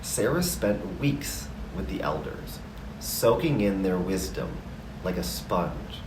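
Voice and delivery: plain reading voice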